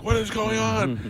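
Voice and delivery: high, loud, drawn-out exclamation of disbelief; retold alarm. Man recalling hair falling out in the shower, alarmed. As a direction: cry it out slowly, high and loud.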